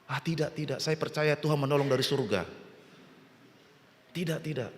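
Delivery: acted refusal, quietly sure of himself; soft refusal. Flood victim turns down the boat, quiet pious refusal.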